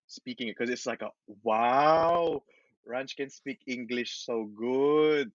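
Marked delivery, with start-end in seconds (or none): 1.4-5.3 drawn-out, slow, impressed wow; mock amazement. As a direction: imitate relatives marvelling, stretching the words. Imitating family marvelling at English.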